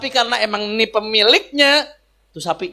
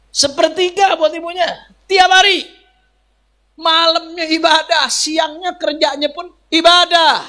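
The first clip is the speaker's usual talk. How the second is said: preaching at full force; 9 semitones higher, slower than usual. Real, not acted. Preacher praising the devoted son to the crowd, high and forceful. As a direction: drive it home to the congregation, slow and high. Preacher hammers home the point.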